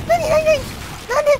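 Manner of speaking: sudden high alarmed burst; startled panic. Real, not acted. Streamer jolted by a sudden shake, startled cry. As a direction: blurt it out, caught off guard. Sudden scare in horror game.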